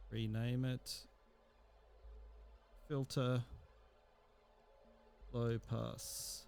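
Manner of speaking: ordinary talk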